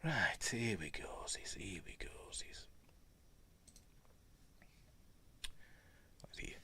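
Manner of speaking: soft unvoiced murmur; a hushed running aside to himself; whisper. Real, not acted. Whispering while searching.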